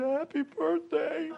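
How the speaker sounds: put-on high, slow small boy's voice; little boy voice. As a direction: say it shyly like a ten-year-old.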